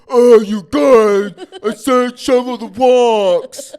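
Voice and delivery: very loud, high, drawn-out holler; yelling out a window. Acted. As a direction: bellow slowly at people down the street, hammed up. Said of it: "yelled out his window".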